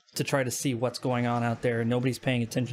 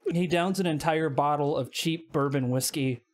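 normal talking voice

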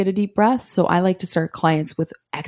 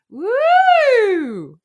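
siren; 4 dB louder than usual. Shown as a demonstration. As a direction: swoop up high and back down. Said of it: "the siren exercise sounds like".

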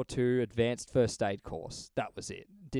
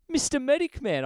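mocking title; 11 semitones higher, 4 dB louder than usual. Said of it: "He comes around and goes".

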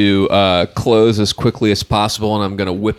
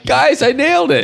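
very high, gleeful boast; excited; 3 dB louder than usual. Real, not acted. Player telling the table of success, excited. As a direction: cry it out high to the group.

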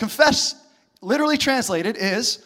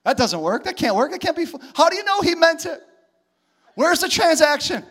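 mock indignation, climbing higher; objecting religious people; 5 semitones higher than usual. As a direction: protest in sputtering, high disbelief. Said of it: "All the religious people are like".

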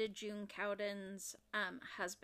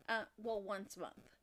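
normal talking voice

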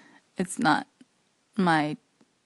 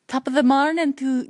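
put-on Irish accent; 3 semitones higher, 7 dB louder than usual. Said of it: "no, that was stupid again".

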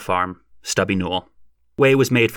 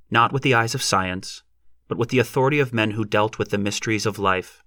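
usual speaking voice

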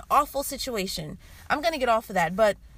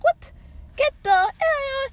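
high, loud, drawn-out disbelief; mock outraged reaction; 14 semitones higher, 3 dB louder, slower than usual. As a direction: act out a shocked, indignant outburst. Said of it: "wouldn't you be like".

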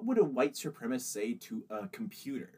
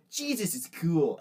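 slow, loud cartoon skater kid; drawn-out skater kid; 5 semitones higher, 5 dB louder, slower than usual. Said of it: "him skateboarding".

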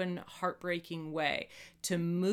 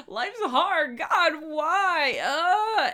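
high, loud mock-despairing wail; histrionic crying; 10 semitones higher, 9 dB louder, much slower than usual. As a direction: overplay the dramatic sob. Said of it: "that histrionic place of like".

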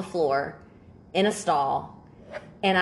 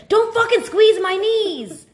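high, slow, louder mock yelp; mock shriek; 7 dB louder, slower than usual. Friend squeezed on the knee, high mock yelp. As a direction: play the ticklish friend yelping.

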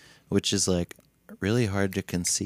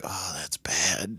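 slow hushed whisper; 3 dB quieter, slower than usual. Retold or imitated. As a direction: whisper it, dismayed. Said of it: "in my dream".

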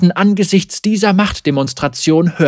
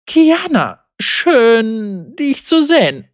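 higher, hesitant uneasy greeting; a nervous sister forcing a welcome; 7 semitones higher, slower than usual. A narrator's reading. Mara greets her angry sister, uneasily and higher. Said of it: "sagte Mara unbehaglich".

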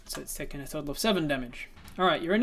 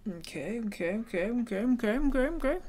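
rising frantic okays, climbing higher; manic; 7 semitones higher than usual. Real, not acted. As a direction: wind yourself up, faster and higher. GM psyching up over rolls.

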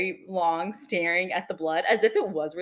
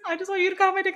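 high-pitched, incredulous mock plea; retold begging; 11 semitones higher, 4 dB louder, much faster than usual. Dreamer quoting the chainsaw kid's demand, retold begging. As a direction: plead it in a much higher voice, half amused. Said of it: "almost like he's just begging for it".

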